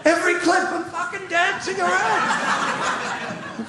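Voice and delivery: high, drawn-out sing-song; mocking exasperation. Comic fed up with dancing in clips, mocking.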